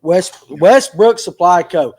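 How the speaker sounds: "Westbrook Supply Co" said slowly and deliberately; careful name repetition. Real, not acted. Host confirming the proper shop name with guest, slow and careful. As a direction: take your time over each part of "Westbrook Supply Co". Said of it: "Is that the proper way to say it".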